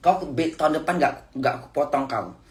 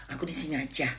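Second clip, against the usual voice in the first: soft, softer sulky pleading; spoiled whiny voice; 2 semitones lower, 7 dB quieter than usual. Retold or imitated. Mother imitating her spoiled refusal to the boss. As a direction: sulk like a spoiled child, softly. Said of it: "ngomongnya kayak manja-manja gitu".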